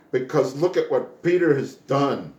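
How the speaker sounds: normal talking voice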